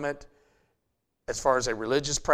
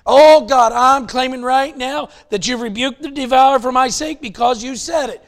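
much louder and higher, hammed-up pleading; mock prayer; 8 semitones higher, 10 dB louder than usual. Preacher mocking believers who claim promises, loud mock prayer.

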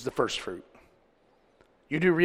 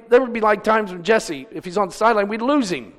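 ordinary talk

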